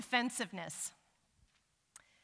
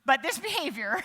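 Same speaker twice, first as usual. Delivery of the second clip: mock scolding; 6 semitones higher, 11 dB louder than usual. Mock scolding her husband.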